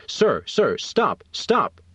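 understated shout, pitch jumping up on stop; a guard's shout, held back in the reading; narrated shout. A narrator's reading. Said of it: "a voice shouting".

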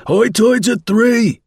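very high, slow, loud call; shouting. A narrator's reading. Dundas shouts to the man left on the sands, shouting. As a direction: shout it long and high into the distance. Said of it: "shouted Dundas again".

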